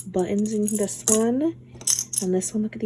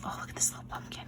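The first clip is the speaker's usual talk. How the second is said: whisper; 15 dB quieter, much faster than usual. Real, not acted. Whispering in delight at gifts.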